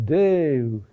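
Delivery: Deus with a long, drawn-out DE; stress on next-to-last syllable. Shown as a demonstration. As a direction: stretch the first syllable of Deus, then let it fall. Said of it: "is on the next to last syllable".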